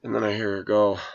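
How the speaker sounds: ordinary talk, nothing marked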